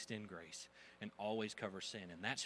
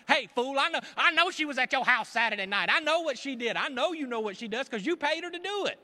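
loud, high, fired-up confrontation; mock call-out; 15 dB louder than usual. Preacher calling out a sinner, loud and high. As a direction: get in his face, shouting.

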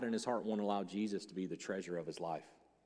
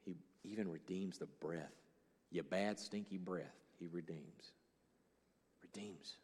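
tender sermon hush; 3 semitones lower, 8 dB quieter than usual. Real, not acted. Tender soft emphasis on redemption.

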